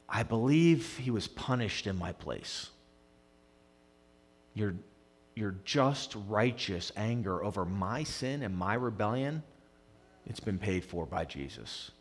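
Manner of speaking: soft, low, reverent prayer; quiet prayer. Acted. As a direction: pray it quietly to the Father. Voicing a believer's prayer.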